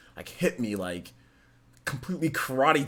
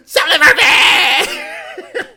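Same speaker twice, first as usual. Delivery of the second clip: loud, high sketch shout; shouted name; 12 dB louder, much slower than usual. Retold or imitated. Streamer reliving the school hallway shout, loud and high. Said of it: "everyone was just going around saying".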